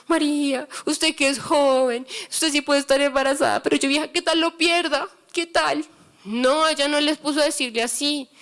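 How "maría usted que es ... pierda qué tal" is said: a hint of a worried old woman whining; mock tearful lament. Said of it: "Ella no se quedó llorando, diciendo". (lamento fingido y suave de mujer mayor con miedo)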